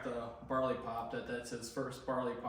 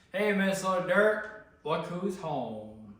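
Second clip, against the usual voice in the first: loud greeting; 6 semitones higher, 8 dB louder, slower than usual. Real, not acted. Greeting returning soldier loudly.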